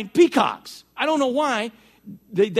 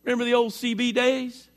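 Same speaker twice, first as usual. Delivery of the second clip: ordinary talk, nothing marked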